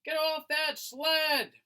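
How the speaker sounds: high, loud warning shout; frightened mother yelling. Acted. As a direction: shout to Jimmy before the sled slides. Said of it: "shouted his mother".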